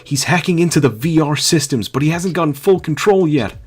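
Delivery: high, loud character voice; high-voiced sidekick. Otacon warning Snake of the hacker, high and loud. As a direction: pitch it way up and keep projecting.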